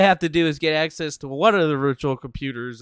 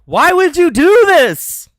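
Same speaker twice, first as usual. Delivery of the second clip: high, loud, slow drawn-out plea; anguished yell; 14 dB louder, slower than usual. Real, not acted. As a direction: howl the question in despair. Scolding the Google engineer.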